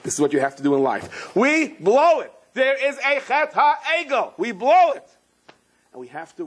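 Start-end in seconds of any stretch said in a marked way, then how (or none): 1.3-5.1 high, drawn-out exclamation; emphatic outcry. Real, not acted. Teacher telling listeners everyone blows it, emphatic outcry. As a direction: hammer each word, voice pitched way up. Preacher proclaims inevitable failure.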